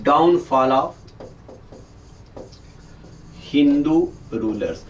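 slow, spaced-out teacher dictation. Acted. Teacher dictating notes slowly to camera. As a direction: read it out slowly so they can write.

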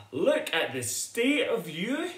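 gasping at the mess; shocked mother. Acted. Mother bear to sooty cub, shocked.

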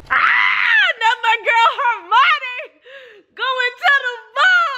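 very high, drawn-out excited shriek; thrilled squeal. Real, not acted. Fan reacting to Hermione's ball date, thrilled squeal. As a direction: squeal with laughter in it, stretching the words.

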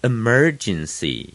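emergency with faint 'uh' and strong MER; light first syllable. Shown as a demonstration. Teacher repeating the model, emergency with light start, strong MER. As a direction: brush past the E of emergency, land on MER. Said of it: "前面的呃也很轻".

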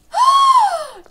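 a sharp high gasp that bursts out loud; big shocked gasp. Real, not acted. Gamer sees the test result, a loud shocked gasp.

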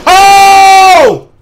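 loud high shocked cry; shocked shout. Real, not acted. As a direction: yell in shock. Game mishap.

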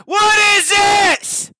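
slow, high, loud disbelief; disbelieving shout. Real, not acted. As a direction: drag it out in shock. Reacts to meme in disbelief.

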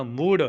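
loud, heavy pronunciation of ਮੂੜ. Shown as a demonstration. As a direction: lean hard on ਮੂੜ. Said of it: "ਭਾਰਾ ਉਚਾਰਨ ਕਰਨਾ".

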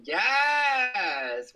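loud high yes; cheering. Real, not acted. Streamer cheering at chat, loud yes. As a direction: shout it out in triumph.

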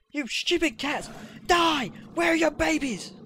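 high, loud attacking shout; battle yell. Pretend. Gamer attacking the stupid cats, furious mock battle yell. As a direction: yell it like you're charging at them.

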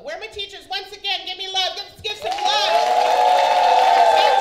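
loud, high call for the teachers to cheer; rally the room, shout it high and loud; screaming. Real, not acted. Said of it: "That is what I'm screaming".

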